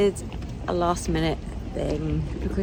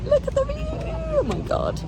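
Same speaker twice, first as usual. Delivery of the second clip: high, delighted gasp at the view; thrilled squeal; excited. Real, not acted.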